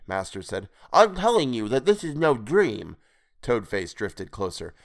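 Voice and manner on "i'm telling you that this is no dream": higher-pitched, insistent creature voice; gloating toad creature. A narrator's reading. Creature correcting his captive, gloating. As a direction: taunt as the toad-man.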